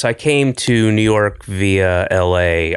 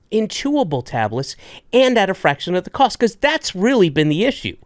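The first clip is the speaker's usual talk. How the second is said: upbeat host ad read, pitched up; 5 semitones higher than usual. Acted. Podcast host reading sponsor ads, upbeat ad voice. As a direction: sell it like a podcast sponsor spot.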